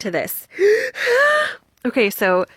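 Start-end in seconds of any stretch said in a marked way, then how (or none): 0.5-1.6 sharp delighted gasp; a big excited intake of breath; surprised gasp of delight. Real, not acted. Unboxing a gift, sudden delight.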